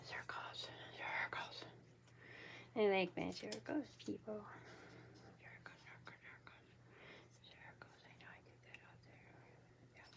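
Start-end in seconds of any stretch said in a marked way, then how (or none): 0.0-1.6 very soft whisper. Real, not acted. Crafter repeating "circles" to themselves, whispering. Murmuring catchphrase to self while crafting. 5.4-10.1 barely audible whisper. Real, not acted. Crafter talking to themselves over their work, whispering. Murmuring to self while working.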